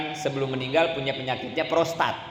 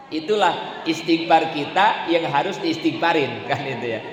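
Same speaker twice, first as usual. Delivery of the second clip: ordinary talk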